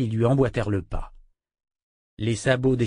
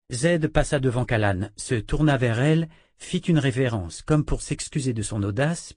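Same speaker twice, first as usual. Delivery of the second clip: ordinary delivery, nothing marked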